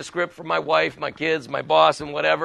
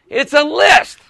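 higher, slow, drawn-out announcement; emphatic answer; 6 semitones higher, 5 dB louder, slower than usual. Shown as a demonstration. Speaker defining inventory for the group, emphatic announcement. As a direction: proclaim the definition to the room.

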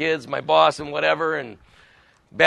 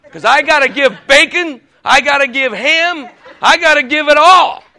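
higher, louder, mounting insistence; pig's emphatic protest; 5 semitones higher, 6 dB louder than usual. Acted. Pig telling the chicken what breakfast costs, emphatic protest. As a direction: hammer each sacrifice as the joke's punchline.

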